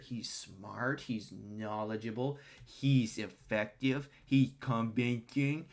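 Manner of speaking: slow, drawn-out mock praise; sarcastic, laboured listing of virtues; mocking recital. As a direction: stretch each item like a ponderous boomer. Mimicking boomers' resume praise.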